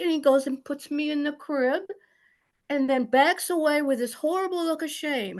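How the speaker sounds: usual speaking voice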